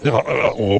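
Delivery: reversed speech; played backwards. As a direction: talk as if the tape runs backwards. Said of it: "comme si tu parlais à l'envers".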